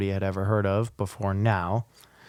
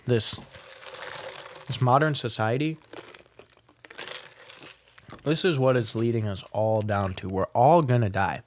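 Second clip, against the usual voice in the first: chewing on ice while talking; ice in mouth. Real, not acted. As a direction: talk around a mouthful of ice. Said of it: "sorry, I'm chewing on ice".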